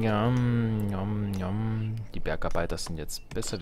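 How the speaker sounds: normal talking voice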